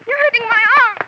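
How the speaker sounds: woman screaming in pain as her arm is held. Acted. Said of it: "the source of the scream".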